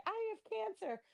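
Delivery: high whiny mock woman's voice; mocking her. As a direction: squeal her complaints up high. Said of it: "she was like".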